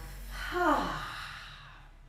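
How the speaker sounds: long open-mouthed sighing breath out; audible exhale. Shown as a demonstration. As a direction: let a deep breath go with an audible "hah". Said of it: "deep breath out through the mouth".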